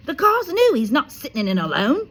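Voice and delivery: high, loud and quick; loud high indignant uncle; loud objection. A narrator's reading. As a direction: bark the refusal up high. Uncle refusing in story.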